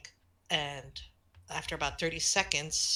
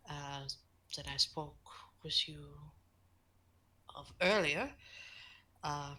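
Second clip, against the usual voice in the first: quiet measured voice; 8 dB quieter than usual. Acted. Johann telling Eve about a shy visitor, softly. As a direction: speak gently as a protective guardian.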